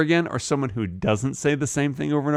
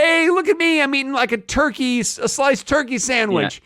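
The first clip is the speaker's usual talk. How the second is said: excited diner; 11 semitones higher than usual. Retold or imitated.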